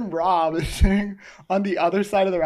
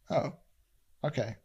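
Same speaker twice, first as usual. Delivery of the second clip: imitating a soft, offhand okay; 4 semitones lower, 10 dB quieter than usual. Imitating the cashier's soft, offhand okay. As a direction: mimic his low, quiet, dismissive okay. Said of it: "he says it like that too".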